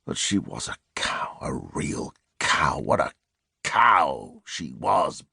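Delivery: hushed, slow whisper of contempt. A narrator's reading. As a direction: breathe it out in a low whisper. Murderer's private bitter thought.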